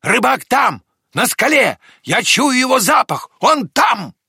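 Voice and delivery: high, loud, excited announcement; dog's shout. A narrator's reading. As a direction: cry out each phrase in eager triumph. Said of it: "закричала собака". (высокий взволнованный крик собаки)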